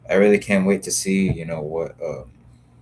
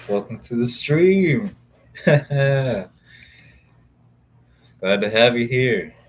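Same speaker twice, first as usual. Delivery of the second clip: laughing greeting; 6 semitones higher, 5 dB louder, much slower than usual. Real, not acted. Greeting a new viewer by name.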